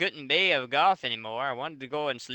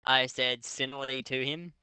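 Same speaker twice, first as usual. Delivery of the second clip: ordinary talk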